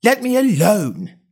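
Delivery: put-on high, slow indignant girl; high-pitched indignant protest. A narrator's reading. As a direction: demand it in a high, offended voice. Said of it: "said Alice indignantly".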